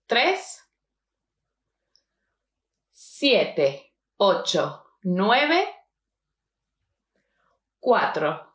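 "uno, dos, tres", "siete, ocho, nueve", "cuatro, cinco, cero, dos" with long gaps between groups; pauses between groups. Shown as a demonstration. Teacher letting learners repeat the number, long pauses after "tres" and "nueve". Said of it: "I'm pausing between the one, two, three".

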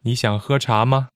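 final 吗 short and unaccented; neutral-tone particle. Shown as a demonstration. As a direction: let 吗 just go with the sentence, lightly. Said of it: "not accented".

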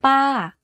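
"Pa" rising a touch and gliding down; falling tone. Shown as a demonstration. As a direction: say "Pa" with a Thai falling tone, slightly up first, then down. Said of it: "Here's an example".

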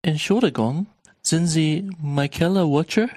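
slow and carefully paced; slow reading. Shown as a demonstration. Teacher reading the dialogue slowly for learners. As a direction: read it slowly, word by word, for learners. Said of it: "the dialogue will be read slowly".

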